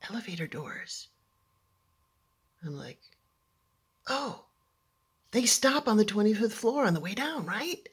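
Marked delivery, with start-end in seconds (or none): 4.1-7.9 higher, hopeful questioning; anxious self-talk. Retold or imitated. Stranded girl asking herself about the elevators, anxiously hopeful. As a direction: reassure yourself, a touch worried.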